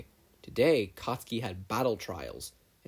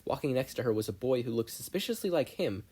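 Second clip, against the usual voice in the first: ordinary delivery, nothing marked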